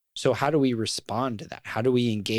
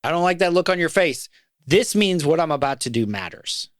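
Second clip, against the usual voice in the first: louder and higher, pressing each word; emphatic quoted rebuke; 4 semitones higher, 4 dB louder than usual. Retold or imitated. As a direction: drive the phrase home.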